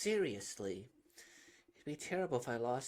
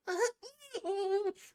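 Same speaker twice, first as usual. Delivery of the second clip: breathless laugh; 5 dB louder than usual. Acted. Laugh while running.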